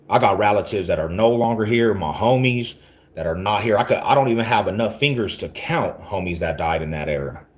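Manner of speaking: ordinary delivery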